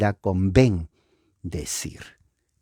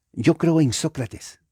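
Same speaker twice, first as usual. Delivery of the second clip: voz aguda fingida; 7 semitones higher than usual. Pretend. Diálogo imaginado, otra voz.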